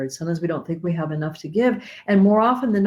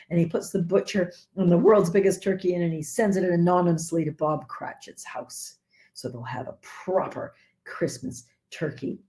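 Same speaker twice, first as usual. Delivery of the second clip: ordinary talk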